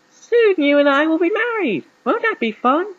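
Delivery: high-pitched, badly faked southern belle; attempted southern accent. A narrator's reading. Jezebel to James about their marriage, attempted southern accent. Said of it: "in her southern s-, accent".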